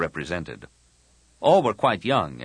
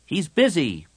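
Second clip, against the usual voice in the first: bossy dismissal; 10 semitones higher, 5 dB louder than usual. Acted. Tahiri heads off the greeting children.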